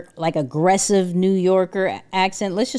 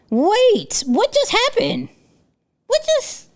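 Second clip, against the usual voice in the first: startled; slower than usual. Real, not acted. Streamer baffled as the AI voice suddenly changes, startled rising disbelief. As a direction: pitch jumps way up in disbelief.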